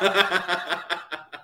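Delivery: rolling burst of laughter; hearty laugh. Real, not acted. Guest hears himself called a storm baba, laughing heartily. Laughs at flattering viewer comment.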